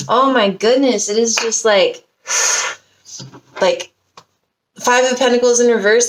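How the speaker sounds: normal talking voice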